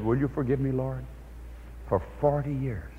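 a quiet, understated tearful confession; woman kneeling, asking forgiveness softly; tearful prayer. Retold or imitated. Woman praying in tears.

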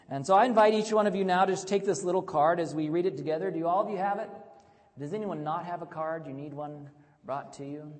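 rousing pulpit delivery; full-force preaching. Acted. Preaching the sermon.

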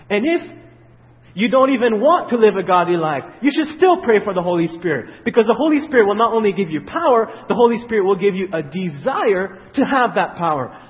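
loud, fervent preaching; preaching build. Acted. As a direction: drive it home to the congregation. Preaching at full force.

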